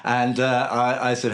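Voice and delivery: usual speaking voice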